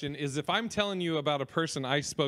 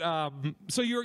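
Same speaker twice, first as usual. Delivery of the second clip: ordinary talk, nothing marked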